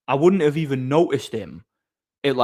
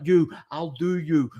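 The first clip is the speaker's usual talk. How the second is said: slow, raised-pitch imitation of a threat; mock threat; 4 semitones higher, slower than usual. Man walking away threatening the doorman, mock menace. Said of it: "he's shouting at me and threatening me".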